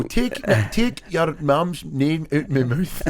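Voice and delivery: exaggerated Scottish impression; Scottish accent. Shown as a demonstration. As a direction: do a broad mock Glaswegian, slowly. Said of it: "You're really good at accents".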